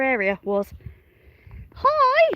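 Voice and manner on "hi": very high, loud sing-out hi; high-pitched greeting. Real, not acted. Farmer greeting her new babies, very high-pitched call.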